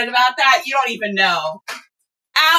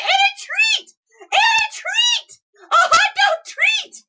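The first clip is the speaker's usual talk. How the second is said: high, stretched squeal of delight; excited squeal; slower than usual. Real, not acted. Finding the hot dog treat in the box, high excited squeal. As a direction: squeal over the little treat.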